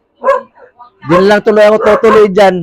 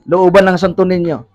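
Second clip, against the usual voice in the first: ordinary delivery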